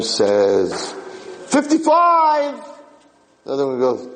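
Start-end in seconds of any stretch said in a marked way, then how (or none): 1.5-2.6 high-pitched drawn-out mock cry; arguing old porch-sitter. Old person announcing a bus count on the porch, high mock cry. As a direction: cry it out high and long, acting an old squabbler. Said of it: "they're all fighting there".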